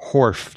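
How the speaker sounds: horf, with an F sound where the S belongs; accented horf for horse. Shown as a demonstration. Host demonstrating accent to Rob: horf for horse. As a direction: say horf, swapping the final S for F. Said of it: "what I'm actually trying to say is horse".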